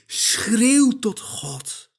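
ordinary delivery

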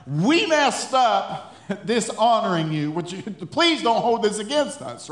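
loud, high mock begging; hammed-up pleading. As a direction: blurt out an over-the-top apology. Voicing Aaron begging for mercy.

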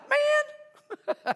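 high exasperated squeak on Man; preacher voicing Jesus to the congregation, exasperated squeak. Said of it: "Jesus replied".

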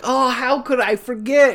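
airy mock realisation; mock Sora. Imitating Sora forgetting something obvious, airy and sheepish. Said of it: "Sora's like".

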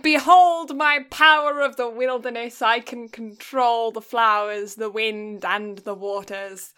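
loud, slow grand proclamation; dark lord. Pretend. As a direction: boom it out like a fantasy overlord. Grand fantasy larp proclamation.

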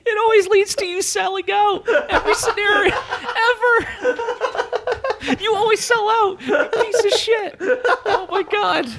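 squealing with laughter, high pitched; laughing. Real, not acted. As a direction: laugh through every word. Laughing through the words.

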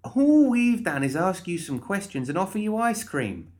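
high, indignant character voice; exasperated Molly. Acted. Molly protesting to the sobbing guard, exasperated.

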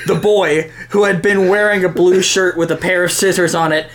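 ordinary delivery

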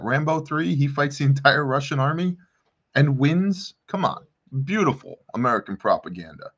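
usual speaking voice

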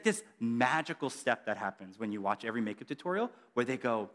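ordinary talk, nothing marked

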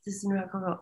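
"This isn't about Coco" whispered, nearly silent; whispering what Hira told her. Retold or imitated. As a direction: breathe it as a secret aside. Retelling a spirit's aside.

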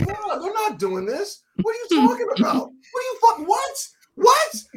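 very high-pitched, sputtering outrage; incredulous. Retold or imitated. Fan watching his hero step aside, incredulous. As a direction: squeal it as if betrayed.

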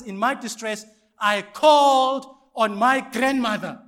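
loud, high, drawn-out scripture quote; booming mock misreading. Shown as a demonstration. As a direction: proclaim the wrong verse at full volume. Deliberate misreading to test church.

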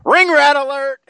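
loud, high-pitched siren-like announcement; mock alarm call. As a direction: shout it like a joking public warning. Jokingly calling out a warning.

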